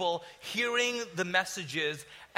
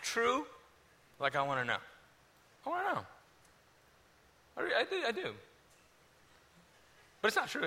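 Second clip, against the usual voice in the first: halting, softened plea with long pauses; hesitant private prayer; 5 dB quieter than usual. Retold or imitated. Doubter at back of meeting praying alone, hesitant prayer. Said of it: "that was my prayer".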